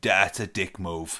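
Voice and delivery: low, slow put-down; unimpressed retort. Retold or imitated. Telling off a customer at work, low unimpressed retort. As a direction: drop it low and drag it out. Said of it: "I went".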